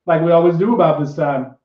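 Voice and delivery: ordinary talk, nothing marked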